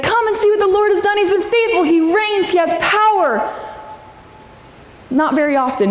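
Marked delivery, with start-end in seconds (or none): 0.0-3.4 high, bright exclaimed praise; joyful psalm prayer. Acted. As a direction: pray it out like the psalmist rejoicing.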